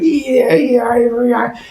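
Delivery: high string of eager yeahs; giddy agreement. Acted. Husband agreeing to wife's plan, high giddy cheering. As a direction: pitch it way up, childishly keen.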